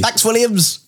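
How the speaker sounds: high-pitched cheery thanks; playful thanks. Acted. Co-host thanking Williams for the invite, high playful cheer. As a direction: call out thanks, pitched well up. Mock thanks to absent team.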